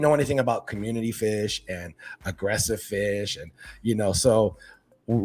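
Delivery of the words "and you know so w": usual speaking voice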